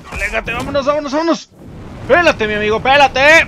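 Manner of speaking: high, loud frantic run-away shouts; screaming to flee in panic; panicked yelling. Real, not acted. Gamer fleeing a monster, frantic high yelling.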